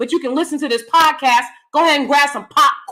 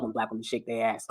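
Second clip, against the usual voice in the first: quiet aside; 14 dB quieter, faster than usual. Real, not acted. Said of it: "I'm sorry, but I".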